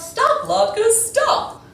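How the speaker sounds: loud high calling; called out. Acted. The rabbi calling out to the runaway latkes, loud. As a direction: shout after them from afar. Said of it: "called out".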